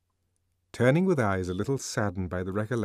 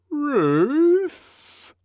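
very high, louder, rising question; creaky questioning call of the liftman; questioningly; 7 dB louder than usual. Acted. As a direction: ask it lost and hopeful.

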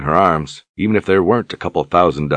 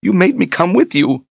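high, tearful accusation. Acted. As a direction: whine it through tears. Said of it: "She wiped tears from her eyes".

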